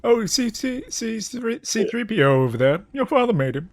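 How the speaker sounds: high, far Alec Guinness imitation; a lightly mocking old Jedi; hammed-up Alec Guinness as Obi-Wan. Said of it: "your Alec Guinness voice".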